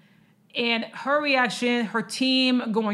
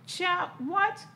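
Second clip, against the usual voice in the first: disbelief; 8 semitones higher, 4 dB quieter than usual. Real, not acted. Reacting in disbelief to triathlon.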